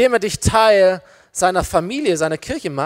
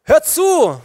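loud, raised call for attention; calling out to crowd; 8 semitones higher, 6 dB louder than usual. Acted. Peter addressing the crowd from a box, loud calling out. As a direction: shout it out over the crowd. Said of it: "rief der Menge zu".

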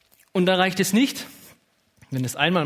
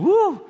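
high loud whoop of joy; excited whoop; 8 dB louder than usual. Real, not acted. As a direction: let out a joyful wuh. Excited shout opening sermon.

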